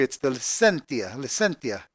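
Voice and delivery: licentia said the Italian way, li-CHEN-tsia, soft and low; Italian accent. Shown as a demonstration. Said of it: "I'm saying it with an Italian accent".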